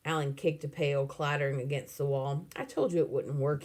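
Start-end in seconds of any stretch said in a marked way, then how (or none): none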